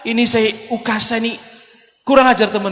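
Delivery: high-pitched, indignant grumble; mock indignation. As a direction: act out an exasperated companion. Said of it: "geram semuanya". (geram, nada meninggi)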